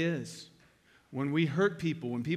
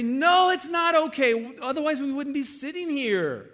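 higher, louder, slow insistence; firm retold correction; 10 semitones higher, 7 dB louder than usual. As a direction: hammer home that it was wrong. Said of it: "And I say".

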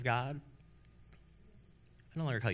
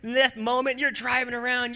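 ordinary talk

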